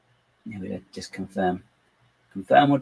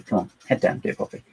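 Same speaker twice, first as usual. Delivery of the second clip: ordinary talk, nothing marked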